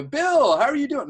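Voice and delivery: very high, loud, exuberant hello; best-friend energy. Shown as a demonstration. Coach greets a random agent as a best friend, loud and elated. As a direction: hail him like a long-lost buddy. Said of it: "a certain type of energy that he had".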